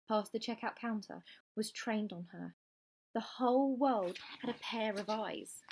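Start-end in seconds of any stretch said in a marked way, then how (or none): none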